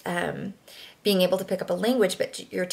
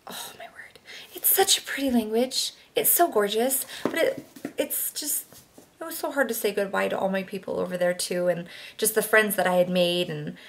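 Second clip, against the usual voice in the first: normal talking voice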